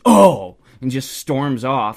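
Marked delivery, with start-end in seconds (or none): 0.0-0.6 very loud, high exasperated outburst; mock pissy huff. Stranger gives up and storms off, mock pissy. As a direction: imitate someone storming off in a huff. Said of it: "He's all pissy".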